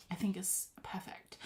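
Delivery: a pleased little whisper; whispered aside. Real, not acted. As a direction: whisper it to yourself.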